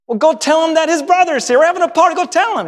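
father urging a servant to fetch his son; eager father. Pretend. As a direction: hurry the servant out.

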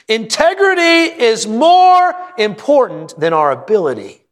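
soaring, drawn-out sermon line; preaching climax. Acted. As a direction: proclaim it from the pulpit.

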